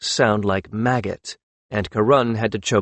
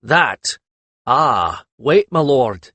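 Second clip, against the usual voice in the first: interrupting; 2 semitones higher, 4 dB louder, much slower than usual. A narrator's reading. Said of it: "Haklatus interrupted him".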